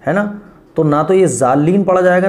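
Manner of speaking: normal talking voice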